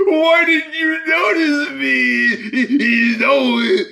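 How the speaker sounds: high, slow, shouted whine; mock whining. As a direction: drag it out like a sob. Mocking lyric's ending.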